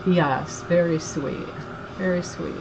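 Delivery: ordinary delivery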